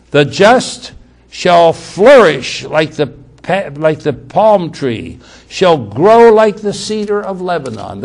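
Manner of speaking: slow, emphatic scripture reading. Acted. Preacher reads a psalm to the congregation, slow scripture reading. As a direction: read the verse out with slow weight.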